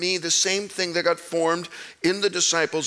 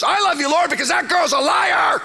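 loud, high blurted prayer; an ex-con shouting his prayer; shouted prayer; 8 semitones higher, 4 dB louder than usual. Retold or imitated. Greg shouts in prayer meeting.

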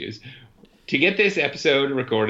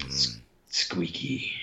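low silly voice; 8 semitones lower, 8 dB quieter, slower than usual. Pretend. Hint in odd low voice.

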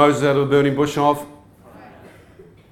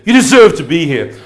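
louder, accusing demon voice, hitting "deserve"; 4 semitones higher, 8 dB louder than usual. Retold or imitated. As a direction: bark the charge like a jeering demon. Demon voice accusing him in hell.

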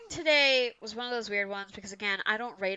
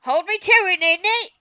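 loud, high hammed-up British voice; over-the-top fake British accent; bad British accent; 8 semitones higher, 13 dB louder than usual. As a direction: ham up a cockney threat. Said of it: "in a bad British accent".

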